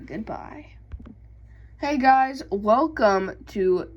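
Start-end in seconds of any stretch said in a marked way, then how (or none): 0.1-0.7 soft breathy farewell whisper; whispered goodbye. Real, not acted. Sign-off after loud farewell.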